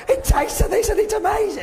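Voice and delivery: hammed-up overexcited presenter. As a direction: gush like a giddy coffee taster. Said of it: "and get overexcited".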